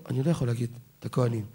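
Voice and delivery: low, soft, hurried imitation of a hoarse priest. Hoarse kohen telling the priest beside him he cannot bless, imitated low and soft. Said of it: "נהיה צרוד".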